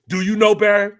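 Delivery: mocking, loud and high; imitating a producer teasing, loud and high; taunting producer. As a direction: needle him with a sing-song jab. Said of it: "he goes".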